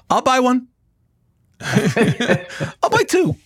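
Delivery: laughing through the words, slowly. Real, not acted. Host laughing at the guest's joke, drawn out with laughter. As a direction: chuckle it out while joking. Joking about buying the book.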